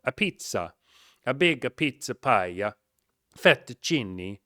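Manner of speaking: hammed-up Italian accent, slow and loud; mock Italian accent. Host jokes to listeners about his Italian, in a hammed-up Italian accent. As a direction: stretch each Italian word out like a cartoon Italian. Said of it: "love that accent".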